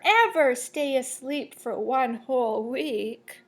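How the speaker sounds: usual speaking voice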